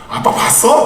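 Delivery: put-on high child's voice asking in surprise; high, astonished kid; surprised little boy. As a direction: play the son caught off guard. Father voices surprised son.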